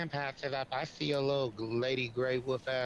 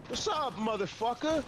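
much higher, hammed-up British accent greeting; mock British accent; 11 semitones higher than usual. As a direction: play a cheeky Brit in a mock British accent. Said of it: "the British accent".

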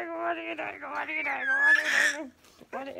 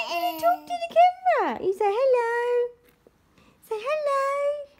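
high and loud delighted cooing; 7 semitones higher, 7 dB louder than usual. Real, not acted. Mother cooing at laughing baby.

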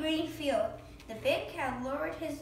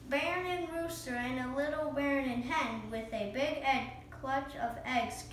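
ordinary delivery

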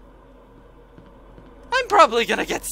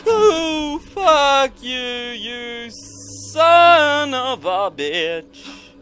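scared angry; 4 semitones higher, 6 dB louder, much slower than usual. Real, not acted.

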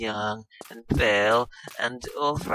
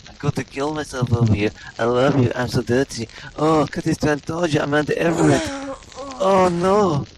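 louder, mock hysterical outburst; hammed-up wailing; 7 dB louder than usual. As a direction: wail it like a melodramatic breakdown. [name]'s hammed-up meltdown.

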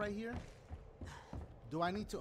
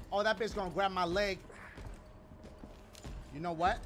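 ordinary delivery

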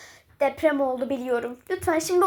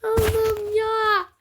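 slow, drawn-out teary sigh; overwhelmed wail; 5 semitones higher, much slower than usual. Real, not acted. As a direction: stretch it out, almost crying. Overwhelmed by the game update. (ağlamaklı, uzatarak)

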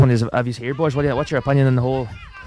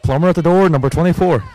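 bingo caller; 4 semitones higher, 6 dB louder than usual. Pretend. Playful bingo calls on air.

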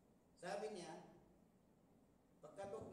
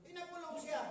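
outraged exclamation; 9 semitones higher, 8 dB louder, faster than usual. Retold or imitated. Echoing the jailing in outrage.

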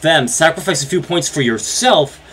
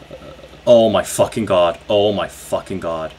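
usual speaking voice

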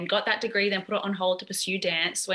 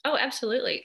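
ordinary talk

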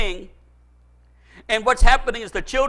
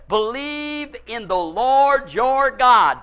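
slow, weighty proclamation; much slower than usual. Shown as a demonstration. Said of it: "this is what the prophet says".

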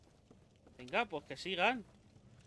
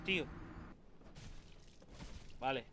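usual speaking voice

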